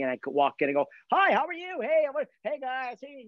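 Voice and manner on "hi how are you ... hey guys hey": extrovert greeting. Acted. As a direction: greet the whole room like a big extrovert. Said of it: "an extrovert like me".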